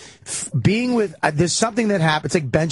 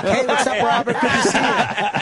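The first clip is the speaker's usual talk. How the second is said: lightly voiced, higher, overly chummy hello; fake friendly greeting; 7 semitones higher than usual. Comedian greeting people he barely knows, mock chummy. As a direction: greet a half-remembered fan with forced cheer.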